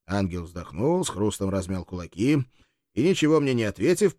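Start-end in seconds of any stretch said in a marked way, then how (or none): none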